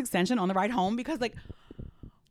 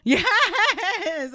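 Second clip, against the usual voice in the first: laughing; 12 semitones higher, 6 dB louder than usual. Real, not acted. Laughing at the vague call.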